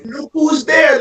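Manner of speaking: shout. Real, not acted. Friend arguing football, shouting at the table. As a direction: look who's there, shouted high. Arguing about the Super Bowl.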